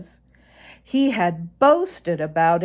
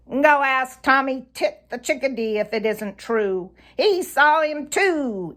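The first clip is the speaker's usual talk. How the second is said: high, quick interruption; Sammy Jay cutting in; 8 semitones higher, 3 dB louder than usual. A narrator's reading. Sammy Jay cuts Reddy off, high and eager. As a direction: cut him off, pitched up. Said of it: "interrupted Sammy Jay".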